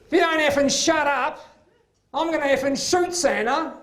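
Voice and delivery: put-on mother losing it at her nagging four-year-old, furious high yell; stressed mum snapping. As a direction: tear into the kid like a mum at breaking point. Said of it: "these words came out of her mouth".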